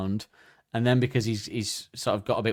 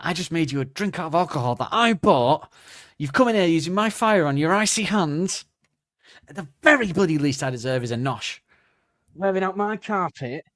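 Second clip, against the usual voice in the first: mock angry host; 6 semitones higher, 3 dB louder than usual. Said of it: "sexually frustrated and angry now".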